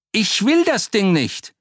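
high, loud protest; panicked refusal. Acted. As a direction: shout it away in panic. Butcher refuses the golem.

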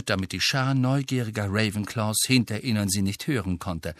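ordinary delivery

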